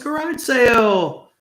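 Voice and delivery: high, loud, slow mock yell of "Garage sale!"; wife yelling. Wife yelling at guitarist husband, high loud mock yell. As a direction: shout it out like the fed-up wife. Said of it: "His wife yells".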